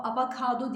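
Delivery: "avokado" with a after the v, a-va-ka-do; wrong pronunciation. Shown as a demonstration. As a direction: turn the first o of "avokado" into an a. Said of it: "avokado değil".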